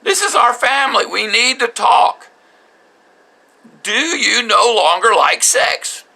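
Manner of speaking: high, loud exasperated outburst, slowing at the end; husband loses his temper demanding an answer from his wife; losing it. Retold or imitated. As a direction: plead in rising frustration at the wife. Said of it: "I start losing it".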